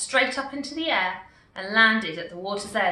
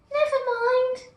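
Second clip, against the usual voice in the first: lightly voiced little girl; 4 dB louder than usual. A narrator's reading. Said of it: "said the little girl".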